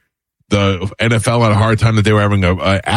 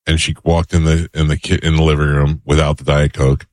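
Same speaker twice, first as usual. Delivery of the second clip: ordinary delivery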